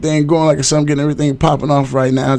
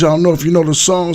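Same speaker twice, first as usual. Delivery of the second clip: usual speaking voice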